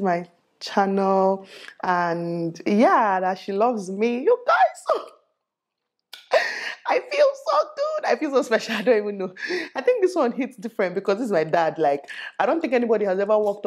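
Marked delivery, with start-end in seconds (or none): 4.2-8.0 high, emotional squeal of delight; overwhelmed happy squeal; squealing with joy. Real, not acted. Overjoyed at dad's fan story. 8.1-9.3 giggling through the words; happy giggle; laughing happily. Real, not acted. Laughing about dad's fan.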